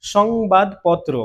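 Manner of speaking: সংবাদপত্র drawn out slowly; slow careful demo. Shown as a demonstration. Teacher slowly modelling সংবাদপত্র before explaining it. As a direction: say সংবাদপত্র slowly, each part spaced out. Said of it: "প্রথমে আমরা এখানে স রাখবো".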